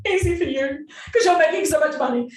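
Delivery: laughing mock sarcasm; sarcastic retort. Answering her coach with laughing sarcasm. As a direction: scoff back with a laugh. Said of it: "I'm like".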